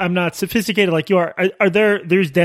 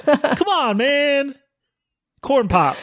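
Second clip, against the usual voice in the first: much higher, hammed-up old Biden voice; Joe Biden imitation; 9 semitones higher, much slower than usual. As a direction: push the Biden impression further. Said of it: "my Joe Biden imitation".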